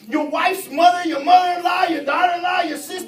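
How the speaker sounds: loud, high preaching shout; preaching at full force. Acted. Preacher urging the church to fight, full-force shout. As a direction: whoop it to the congregation.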